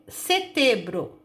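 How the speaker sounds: SETEMBRO said "setebro", with an oral E and no M; pronúncia errada sem M. Shown as a demonstration. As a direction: drop the nasal from the middle of "setembro". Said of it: "É setembro ou é setembro?". ("setembro" dito "setebro", sem som nasal)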